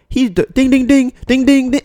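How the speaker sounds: high, loud rapid ding-ding doorbell mimic; imitating doorbell. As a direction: ring it out like an impatient finger on the bell. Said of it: "he rang the doorbell like he lives here".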